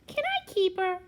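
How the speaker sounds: high little begging voice; baby bear. A narrator's reading. Baby Bear begs to keep Goldilocks, high little voice. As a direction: plead in a small high voice. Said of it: "said the baby bear".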